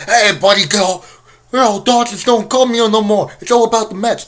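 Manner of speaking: hammed-up New Yorker calling out to a buddy in a New York accent; a bad, half-hearted New York accent. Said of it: "I can't do New York accent".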